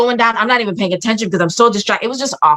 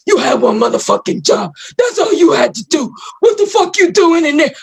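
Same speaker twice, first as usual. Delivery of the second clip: high, strained furious rant; yelling, cussing him out; 9 semitones higher than usual. Retold or imitated. Wife cussing out husband after surgery, strained yelling. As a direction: re-enact a tirade climbing higher as it goes. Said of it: "I was yelling at him like this".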